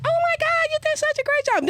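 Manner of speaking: very high-pitched imitation of a gushing woman; imitating excited praise. Guest imitates Issa congratulating him on his scene, excited gushing. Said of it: "She's like".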